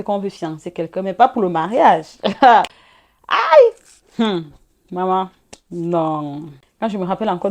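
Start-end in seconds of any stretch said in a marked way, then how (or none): 3.3-4.2 sharp high "aïe" cry of shock. Real, not acted. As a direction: yelp it out high in disbelief. Recalling the shocking moment.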